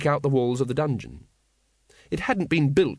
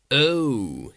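a lightly voiced unruffled lord; cool, polite nobleman; calm aristocrat. A narrator's reading. Patrician greets Vimes calmly.

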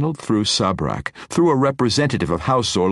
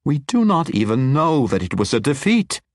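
higher, louder, firm correction; firm reminder; 8 semitones higher, 3 dB louder than usual. A narrator's reading. Matron reminding the wizard, firm. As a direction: set him straight. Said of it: "reminded him".

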